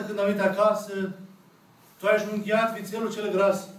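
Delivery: usual speaking voice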